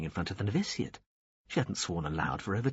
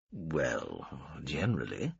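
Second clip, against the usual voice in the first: lower, slow, drawled admiration; lightly voiced wealthy man; 7 semitones lower, 5 dB quieter, slower than usual. A narrator's reading. Veppers admiring the alien's ship, lightly voiced. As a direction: sound lazily amused.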